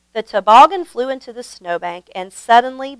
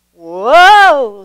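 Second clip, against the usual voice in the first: louder startled whoa; sputtering whoa; 4 semitones higher, 10 dB louder than usual. A narrator's reading. Grandpa Dan as the toboggan swerves left, startled and louder. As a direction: lurch into it as the sled swerves. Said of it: "sputtered Grandpa Dan".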